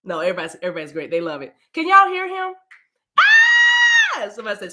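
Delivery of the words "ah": high joyful shriek; delighted shriek. Real, not acted. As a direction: squeal with delight. Mother cries out in delight.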